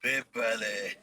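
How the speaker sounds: usual speaking voice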